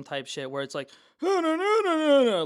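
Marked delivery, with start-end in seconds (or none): 1.2-2.4 loud, high locker-room yelling; hammed-up coach shouting; football coach bark. Shown as a demonstration. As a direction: bellow from a tight stomach and chest. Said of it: "think football coach, like, locker room".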